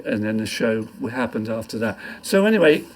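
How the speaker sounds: ordinary talk, nothing marked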